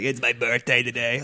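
raspy diner waitress; smoker woman voice. Retold or imitated. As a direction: play the tired waitress. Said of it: "And then she's like".

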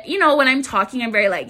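ordinary talk, nothing marked

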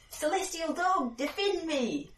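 high-pitched theatrical incantation; grand mock invocation; dramatic summoning. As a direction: call up a magical guardian, slowly at first. Player acts out summoning a spell.

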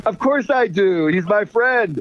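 high, triumphant corny comeback; dad-joke punchline. Retold or imitated. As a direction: deliver the silly punchline with relish. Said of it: "I come back with".

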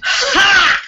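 triumphant. Real, not acted. Player cheers spider kill.

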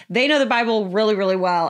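usual speaking voice

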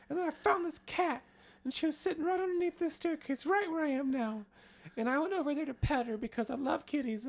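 put-on high, breathless girl voice; Jane voice. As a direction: tell the tale way up high. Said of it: "And she's like".

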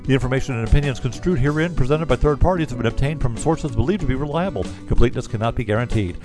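rapid-fire compliance read; fast legal disclaimer. Acted. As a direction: rattle off the disclaimer quickly. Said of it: "recorded disclaimer read".

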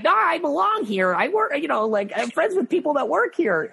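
high, flustered protest; mock-panicked pleading; panicked insisting. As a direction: squeak out a desperate excuse. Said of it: "and I'm like".

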